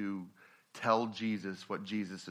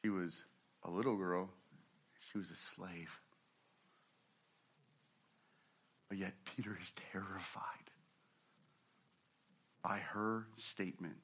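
low, hushed sermon storytelling, drawing listeners in; 3 semitones lower, 9 dB quieter than usual. Acted. Preacher hushes the congregation into the story, hushed. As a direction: lean in and tell it almost under your breath.

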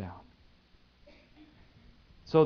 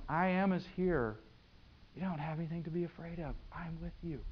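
quiet Jesus calming the disciples; soft, voice dropping away as you reassure; soft reassurance; 7 dB quieter than usual. Acted. Jesus to scared disciples in the storm, softly reassuring.

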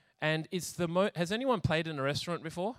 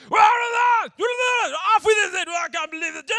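much higher and louder, drawn-out roar of rage; a raging king bellowing at his court; king's furious shout; 13 dB louder, much slower than usual. Acted. King Saul in a fit of rage shouting at his court. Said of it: "you would hear this".

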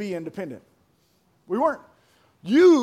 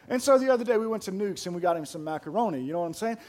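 ordinary delivery

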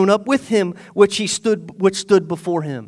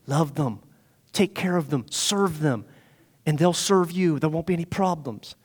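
soft, lower, reassuring advice; gentle counsel; 3 semitones lower, 7 dB quieter than usual. Retold or imitated. Old men advising the new king, gentle low reassurance. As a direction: drop low and counsel them kindly.

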